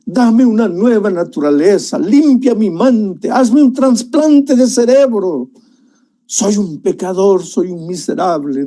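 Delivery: David in the cave begs God for forgiveness, tearfully pleading; tearful plea. Acted. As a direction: beg God for mercy, half in tears. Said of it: "Y llorando le dijo".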